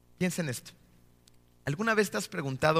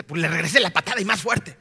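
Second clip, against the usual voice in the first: louder, lightly acted childish comeback; mock defiant boast; 2 semitones higher, 7 dB louder than usual. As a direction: play someone proudly owning up to payback. Mock adult boasting of retaliation. (desafío infantil fingido, más fuerte)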